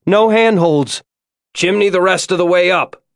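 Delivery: high-pitched call over a distance; shouted from below. A narrator's reading. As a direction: shout up to someone far above. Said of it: "like he'd shouted up to her".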